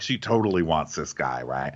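ordinary delivery

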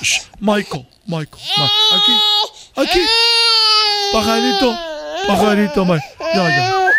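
put-on hushing and cooing to a baby, laughing through it; soothing a crying baby. Mother hushing her crying baby with cooing and shushing. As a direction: rock the baby, coo and shush, mock-tender.

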